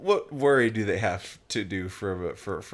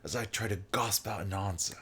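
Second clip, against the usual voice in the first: put-on British reading; British accent. Shown as a demonstration. Friend rereads the narration to show the word, British accent. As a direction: read it in a British accent, gasp as "gosp". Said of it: "but with a British accent".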